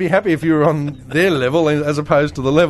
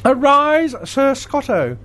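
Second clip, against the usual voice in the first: solemn regal knighting; 9 semitones higher, much slower than usual. Pretend. Mock knighting ceremony.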